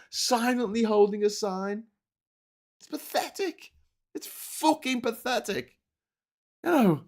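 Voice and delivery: ordinary talk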